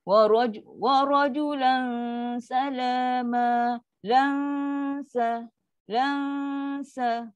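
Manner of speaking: slow "rajulan", long hum before "salama"; low light dengung. Shown as a demonstration. Teacher shows students how it sounds, held dengung. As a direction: isolate "lan sa-" with held nasal and thin s. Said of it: "Sebab tu bunyi dia".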